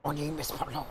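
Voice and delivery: soft, low mock Pablo accent. Friend taking over the Pablo bit, quiet mock accent. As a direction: mumble it as sleazy Pablo. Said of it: "doing the Pablo voice".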